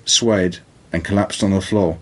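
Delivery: plain reading voice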